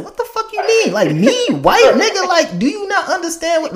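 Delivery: put-on high, loud indignant kid voice, laughing at the end; cousin's outraged reply. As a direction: play the little girl scandalized. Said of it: "she was just like".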